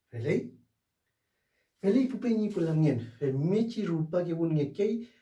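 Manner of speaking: ordinary talk, nothing marked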